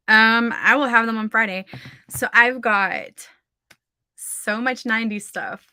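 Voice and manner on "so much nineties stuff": normal talking voice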